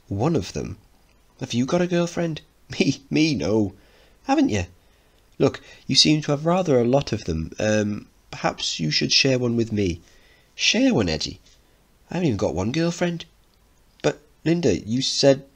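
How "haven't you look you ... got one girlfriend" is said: put-on high, excited kid voices; eager young boys. Edward quizzes Mickey, high and eager. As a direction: bounce it like an eager boy.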